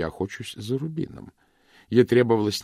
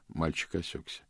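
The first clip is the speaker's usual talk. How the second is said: ordinary delivery, nothing marked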